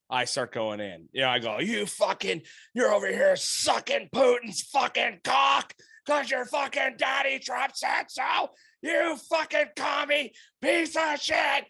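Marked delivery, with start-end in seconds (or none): 1.6-11.6 furious yelling, slow and high; screaming. Retold or imitated. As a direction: scream it at him in rage. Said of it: "I'm just screaming at him".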